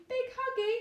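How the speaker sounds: put-on high, hopeful toddler asking; baby voice. Baby asking mother for a cuddle, high toddler voice. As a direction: beg for a hug like a tiny child.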